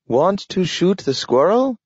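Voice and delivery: high, talking dog; a dog speaking in a high voice. A narrator's reading. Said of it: "he said".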